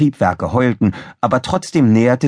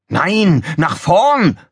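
high, loud bellowed order; Krawall bellowing; 9 semitones higher, 4 dB louder, much slower than usual. A narrator's reading. Officer overrules engineer at the controls, bellowing. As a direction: bellow the countermand, pitch up. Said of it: "brüllte Krawall".

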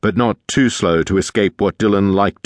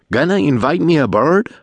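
cheeky call up; 8 semitones higher than usual. Acted. Dillon calls up from the water.

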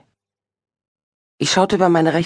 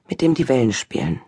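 ordinary delivery, nothing marked

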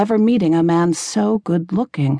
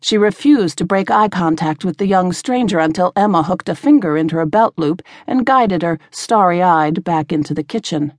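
usual speaking voice

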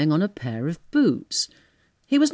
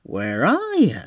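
amazed; slower than usual. Acted. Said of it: "the dawn of a great amazement".

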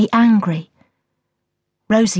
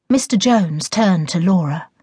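ordinary delivery

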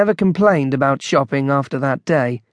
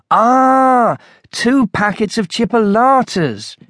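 higher, drawn-out "ah" of discovery; dawning realisation; 7 semitones higher, 3 dB louder, slower than usual. A narrator's reading. Shopper finally deciphers his list, pleased realisation. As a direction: let the penny drop, pitched up. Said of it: "Then light dawned".

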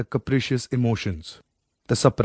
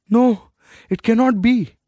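loud, high cry of denial; anguished cry; 10 semitones higher, 5 dB louder than usual. Acted. A son cries out as his mother reveals the truth. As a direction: scream it out in disbelief. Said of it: "he cried".

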